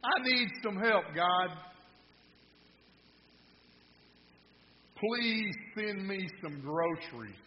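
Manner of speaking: earnest acted appeal, easing off toward the end; pleading prayer. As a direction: beg God aloud for help. Lady prays aloud for groceries.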